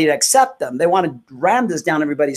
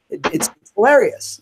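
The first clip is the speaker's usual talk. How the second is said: ordinary talk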